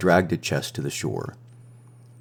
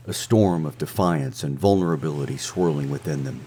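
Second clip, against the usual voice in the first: ordinary delivery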